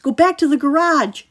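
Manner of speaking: high, loud urgent shout; boy shouting at his brother. A narrator's reading. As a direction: yell it in his face. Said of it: "he shouted in Ryan's face".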